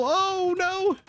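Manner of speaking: very high startled cry; sudden rising dismay; shocked oh no. Real, not acted. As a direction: jump up in alarm. Unit converted mid fight.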